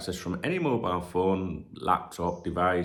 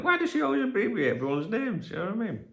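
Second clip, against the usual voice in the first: ex-wife's puzzlement; 13 semitones higher than usual. Retold or imitated.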